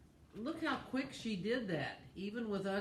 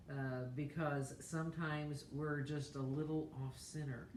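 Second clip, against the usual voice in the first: soft, lower reverent grace; prayer; 3 semitones lower, 3 dB quieter than usual. Acted. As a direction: pray gently over the meal. Leads a mealtime prayer.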